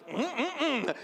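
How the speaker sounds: high, playful "mm-mm-mm" of relish; imitated hungry lions. Lions smelling barbecue sauce on extremists, playfully hungry humming. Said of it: "And the lions were like".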